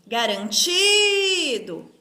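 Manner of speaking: slow "Garantido", swelling on the next-to-last syllable; stressed syllable called out. Shown as a demonstration. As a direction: call out "Garantido", holding the TI. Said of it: "Eu chamo a palavrinha como se".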